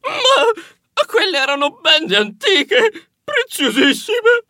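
high-pitched theatrical wail, bursting into sobs; desperate crying. A narrator's reading. Someone in tears over precious ancient bandages, desperate wailing. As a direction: cry it out in despair, voice way up high. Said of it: "scoppiò a piangere come una fontana, disperato".

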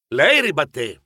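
ordinary delivery, nothing marked

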